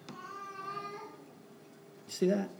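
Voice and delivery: usual speaking voice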